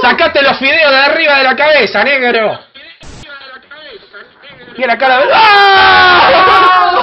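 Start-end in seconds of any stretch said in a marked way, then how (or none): 4.8-7.0 mock scream. Sudden scream at stranger.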